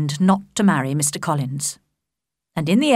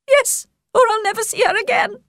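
much higher, agitated mother voice; a flustered mother begging for help, pitched up; fussy Mrs Bennet. Acted. Mrs Bennet threatens daughter.

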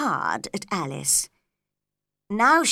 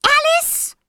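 very high, loud call of a name; calling out loudly; 9 dB louder than usual. A narrator's reading. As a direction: call her name across the hall. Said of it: "he called out loudly".

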